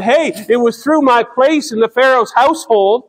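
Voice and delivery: preacher lightly voicing Moses' thoughts; Moses reasoning aloud. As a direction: lightly act out Moses making his case. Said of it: "he may have thought that".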